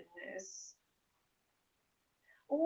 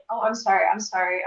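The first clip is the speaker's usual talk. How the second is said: ordinary talk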